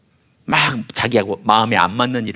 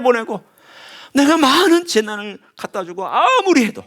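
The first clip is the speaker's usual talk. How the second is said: higher, pleading cry of frustration; anguished God; 10 semitones higher than usual. Retold or imitated. God crying out over His unrepentant people, anguished. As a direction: lament like a father who tried everything. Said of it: "하나님이 보시다가 보시도 못해서".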